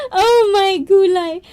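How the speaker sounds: high, loud "Oh my gulay"; delighted high exclamation; excited. Real, not acted. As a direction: squeal it, overjoyed.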